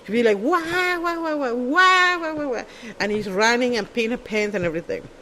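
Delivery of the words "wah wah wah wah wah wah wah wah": hammed-up high wailing wah-wah laugh; high hysterical laugh impression; imitating her hysterical laugh. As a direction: mimic a friend cackling out of control, much higher. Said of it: "the way she laughed".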